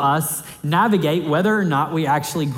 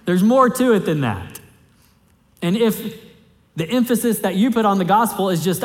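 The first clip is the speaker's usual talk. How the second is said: ordinary delivery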